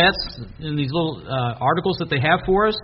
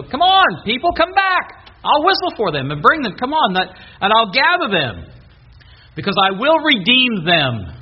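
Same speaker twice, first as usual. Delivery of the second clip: much higher, louder calling out; God calling his people back, loud and high; 6 semitones higher, 5 dB louder than usual. Acted. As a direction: call them home like shouting across a field.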